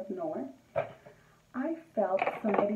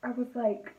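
ordinary talk, nothing marked

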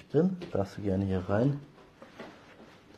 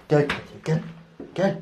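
cooing pet talk; 7 semitones higher, 5 dB louder than usual. Real, not acted. Coaxing a cat, pet voice.

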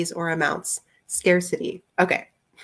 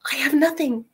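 higher-pitched character voice; Rosie's reply; 7 semitones higher than usual. A narrator's reading. Rosie shaking her head at Pete, higher-pitched voice. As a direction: lift into her voice to admit it.

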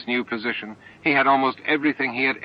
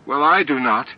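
firm, louder and higher flat denial; plainly spoken, candid objection; blunt and candid; 5 semitones higher, 7 dB louder than usual. A narrator's reading. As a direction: state it bluntly, no softening. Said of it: "bluntly and with candor".

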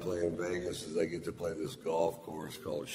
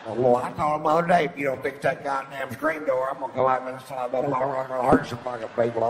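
mock trashy woman; 6 semitones higher, 8 dB louder than usual. Mocking the chatty woman behind him, loud hammed-up squawk. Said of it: "The kind of chicks".

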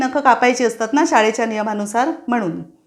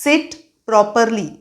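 slow teacher command; much slower than usual. Shown as a demonstration. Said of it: "व्यवस्थित बसा असंही teachers मुलांना सांगतात".

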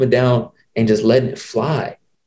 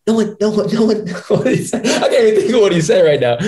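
laughing; 11 semitones higher than usual. Real, not acted. Laughs while struggling to recall.

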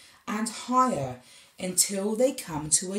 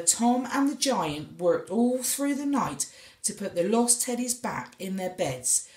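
usual speaking voice

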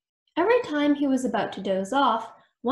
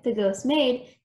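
ordinary delivery

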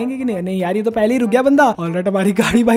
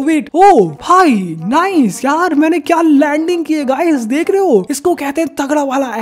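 higher, drawn-out thrilled bragging; excited boast; 4 semitones higher than usual. Real, not acted. Bragging to viewers about his landing, excited and high.

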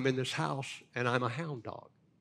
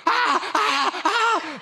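waking scream; 12 dB louder, much slower than usual. Retold or imitated. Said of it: "I wake up screaming".

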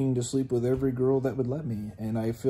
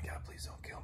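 barely audible whispered plea, much softer; whispered prayer; 17 dB quieter than usual. Retold or imitated. Frightened man on his bunk begging God, in a whisper. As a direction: breathe the words out, frightened.

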